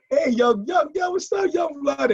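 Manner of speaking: high, excited call of welcome; greeting voice. Retold or imitated. Club regulars greeting the kid, high excited welcome. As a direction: sing out a big hello to the newcomer. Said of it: "you know".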